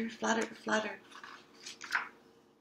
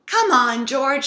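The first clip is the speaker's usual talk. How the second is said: louder, higher call up the stairs; excited call; 5 semitones higher, 10 dB louder than usual. Acted. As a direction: hurry your brother along. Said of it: "shouted Peppa".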